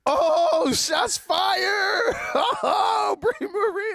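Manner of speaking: very high, loud shouting with laughter; mock-scandalised whooping; playful outraged shriek. Real, not acted. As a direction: squeal and holler at a friend's dig. Playful reaction to being called old.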